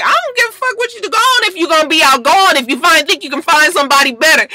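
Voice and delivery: mock angry. Imitating [name] telling [name] off, fast and shrill. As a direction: rattle it off in a mocking fury. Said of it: "And he was like".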